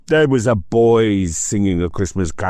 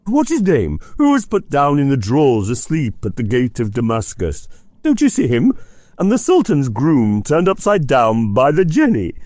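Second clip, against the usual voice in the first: high, overexcited voice on the edge of tears; between laughing and crying; 7 semitones higher than usual. Acted. Scrooge cheering storybook figures he sees, half laughing half crying. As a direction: gabble with childish glee. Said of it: "in a most extraordinary voice between laughing and crying".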